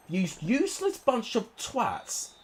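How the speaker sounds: exasperated insult. Real, not acted. Gamer cursing his players, slow and disgusted. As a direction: spit it out at the useless squad.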